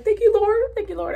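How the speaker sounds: high, loud thankful outburst, retold; grateful cry to God. Retelling her thanks on a happy day, loud and high. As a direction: burst out thanking the Lord. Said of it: "I was literally crying".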